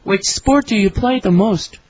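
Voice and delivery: usual speaking voice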